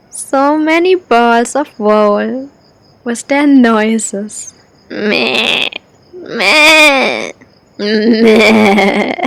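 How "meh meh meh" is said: three imitated sheep bleats; short mock bleats, spaced apart.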